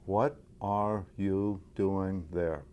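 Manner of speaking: "What are you doing there?" said evenly, word by word, slowly; slow, level delivery with equal weight on every word; no inflection. Shown as a demonstration. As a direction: keep every word even, stressing none. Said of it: "as evenly as possible without inflection".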